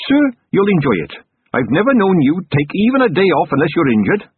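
put-on polite, earnest young officer; earnest young captain. Narrator voices Carrot.

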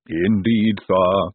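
smug Igor servant voice; smugly deferential. A narrator's reading. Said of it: "said Igor smugly".

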